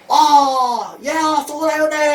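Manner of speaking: over-the-top high gushing shout; overwhelming joy. Acted. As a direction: exaggerate the delight, loud and very high. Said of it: "nggak perlu sampai overwhelming". (seruan girang berlebihan, tinggi dan keras)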